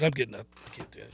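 low, very soft murmur; hushed retelling. Retold or imitated. Host retells telling his sleeping wife he's up, low and hushed.

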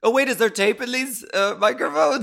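high, hurried mock surprise; mock alarm. Co-host pretends to discover the microphones, in mock alarm.

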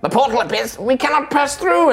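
high tiny soldier voice, urgent; fairy warrior. Acted. GM voicing fairy warriors calling a retreat, high and urgent. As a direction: squeak orders like a tiny captain.

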